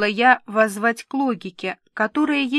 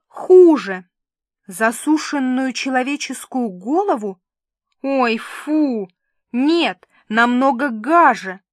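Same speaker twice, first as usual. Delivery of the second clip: excited teasing; 3 semitones higher, 6 dB louder than usual. Acted. Milka teasing with gruesome guesses.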